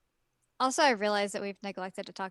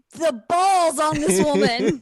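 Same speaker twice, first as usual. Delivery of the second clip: loud, slow, amazed; drawn out in disbelief; loud slow awe; 6 semitones higher, 10 dB louder, much slower than usual. Retold or imitated. Awed exclamation.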